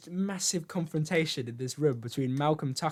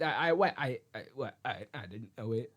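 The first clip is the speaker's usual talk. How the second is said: mock stammer sinking lower and softer; flustered stutter; 6 semitones lower, 7 dB quieter than usual. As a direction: stumble through false starts, then fade away. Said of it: "he just does a full Boris".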